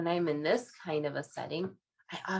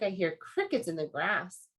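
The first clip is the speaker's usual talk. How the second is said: ordinary delivery, nothing marked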